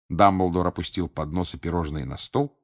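ordinary delivery

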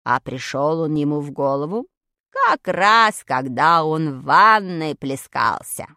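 very low, slow character voice; Vadik explaining. Acted. Vadik reminding friends about Archimedes, low slow lecture. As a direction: recite it deep and measured.

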